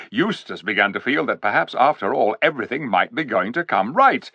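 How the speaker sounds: ordinary delivery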